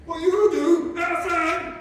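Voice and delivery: hammed-up angry dad; angry dad routine. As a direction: owner lecturing the cat as a mock angry dad. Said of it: "A time for the angry dad routine".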